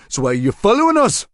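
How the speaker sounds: put-on high, loud, harsh local-accented accusation; cornered local man hurling the accusation back; enraged suspect. A narrator's reading. Suspect with a knife accusing Marshall, harsh angry local voice.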